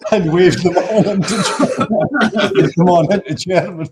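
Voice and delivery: can barely get the words out for laughing; laughing through words. Real, not acted. Teller finishing his border story, laughing hard through the words.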